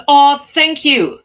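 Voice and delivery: loud, delighted, drawn out; excited thanks. Real, not acted. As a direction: gush your thanks. Thanked for nice background.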